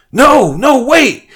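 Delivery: very high, loud cry to stop; desperate plea. Acted. Deer Man crying out to stop the mob, very high. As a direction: beg them to stop.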